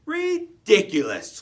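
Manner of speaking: loud, drawn-out scoff; a parent tortoise crying out in disbelief; cried out. Acted. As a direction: scoff loudly at the silly idea. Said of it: "cried Mister Tortoise".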